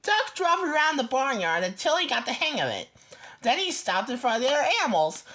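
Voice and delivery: ordinary delivery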